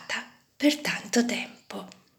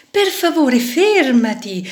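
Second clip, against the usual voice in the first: pleading shout; 6 semitones higher, 5 dB louder than usual. A narrator's reading. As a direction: cry out begging it to stay. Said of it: "gridarono alla vite le piastre d'acciaio".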